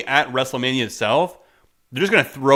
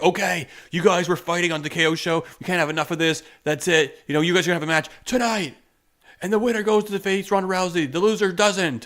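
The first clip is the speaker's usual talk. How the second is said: lightly voiced fed-up authority, higher; imagined show authority; 5 semitones higher than usual. Show boss announces a surprise match to feuding wrestlers, mock decree. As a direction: pitch it up like an official laying down a match. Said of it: "where it's like".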